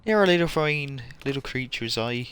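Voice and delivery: put-on hammy Irish accent hunting for a pot of gold; a not-very-good Irish accent, slowed down. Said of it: "It is an Irish accent".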